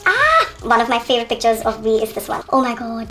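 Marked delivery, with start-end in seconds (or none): 0.0-0.5 high delighted squeal; short fangirl shriek; excited squeal. Real, not acted.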